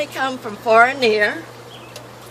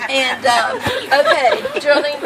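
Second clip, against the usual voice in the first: chuckling, louder through laughter; laughing; 6 dB louder than usual. Real, not acted. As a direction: laugh it off and move on. Laughing off the teasing.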